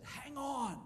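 high yelled warning; shout. Retold or imitated. Warning his wife in the car, high shout. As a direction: yell it to brace your wife. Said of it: "I just yelled at her".

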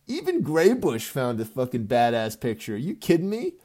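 usual speaking voice

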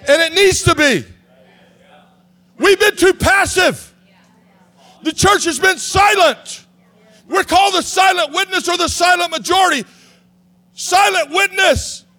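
rally the church out of passivity; shouted high preaching, slowing on the punchline; full-force preaching. Acted.

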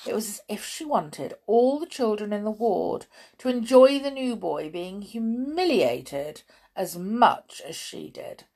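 plain reading voice

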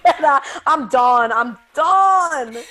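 loud playful mock disgust; teasing a friend, loud mock exasperation; mock outrage. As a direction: shout it in fake disgust at a friend.

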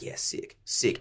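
whispered, offhand approval; barely voiced, dismissive; muttered under breath. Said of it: "I was like".

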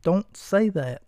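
ordinary talk

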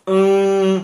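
"mm" held long through the nose; nasal sound. Shown as a demonstration. Teacher models the sound for practice at home, nasal "mm". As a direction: sustain "mm" as a steady nasal hum. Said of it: "Porque el sonido es nasal".

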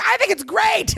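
high, gushing mock delight; hammed-up supportive squeal; imitating overacted enthusiasm. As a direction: cheer it like a bad actress. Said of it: "doing a terrible job of acting".

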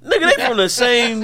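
high, loud comic exasperation; shouted rebuttal. Real, not acted. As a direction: shout down the objection. Keeps shouting down friend's objection.

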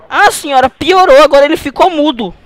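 high, loud distressed complaint; mock alarm. Prank father telling the healer his son got worse, mock alarm.

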